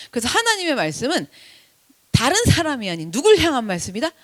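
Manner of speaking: normal talking voice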